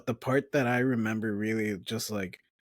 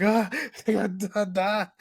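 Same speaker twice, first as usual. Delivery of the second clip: high, loud mock panic, laughing; 10 semitones higher, 5 dB louder, slower than usual. The flying demon attacking them, high mock panicked yelp. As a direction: yelp it like kids under attack. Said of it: "and we're just like".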